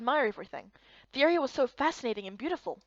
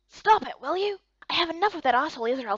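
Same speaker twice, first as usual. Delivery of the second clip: sharp, much higher, louder outburst; angry snap; 4 semitones higher, 5 dB louder than usual. Acted. Annoyed companion snapping at the helpful friend to stop. Irritated retort to unwanted advice.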